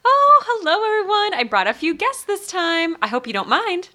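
high, bright-toned character greeting; lifted, sing-song hello to the room; Holly's cheery greeting. Acted. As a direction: sweep in as a friendly guildmate with guests. Player voicing Holly arriving.

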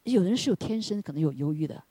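ordinary talk, nothing marked

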